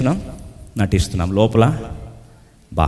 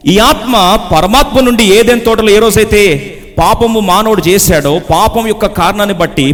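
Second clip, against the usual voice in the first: raised, driving sermon voice; forceful preaching; 8 semitones higher, 5 dB louder than usual. Acted. Preacher explaining death as separation from God, forceful. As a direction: drive the explanation home to a congregation.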